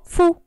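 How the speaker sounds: fu, one quick push of voice; syllable demo. Shown as a demonstration. Teacher showing a two-sound syllable, one clear beat. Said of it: "son dos sonidos en un solo golpe de voz".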